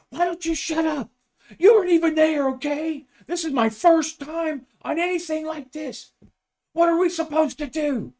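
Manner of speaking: put-on high, loud furious yell of a young rookie; screaming. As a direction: lose it completely, screaming at her. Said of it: "he starts screaming".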